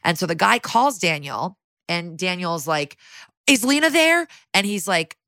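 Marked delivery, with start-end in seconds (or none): 3.5-4.3 high, anxious question, lightly acted; mock-anxious, pitched way up; scared. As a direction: imitate a worried man on the phone. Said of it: "He sounds scared".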